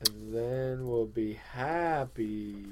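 slow, softened devotee drone; mind-controlled trance. Pretend. Devotee promises co-host happiness, mind-controlled trance. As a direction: drift through it slowly, as if brainwashed. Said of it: "complete and total mind control".

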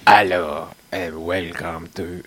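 slow mock French accent; hammed-up French accent greeting. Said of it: "in French accent".

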